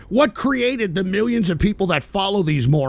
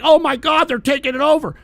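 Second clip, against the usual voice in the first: hammed-up panic, higher and louder; mock panic; 7 semitones higher, 4 dB louder than usual. Mocking viewers' fearmongering, hammed-up panicked voice. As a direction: fake alarm, mocking them. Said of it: "are you gonna be like".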